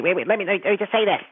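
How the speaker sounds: lightly voiced eager interrupter jumping in; mimicking a friend who has to break into a phone call; Bob's voice. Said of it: "that Bob-like voice".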